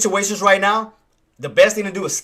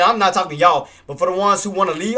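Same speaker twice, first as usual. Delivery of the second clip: usual speaking voice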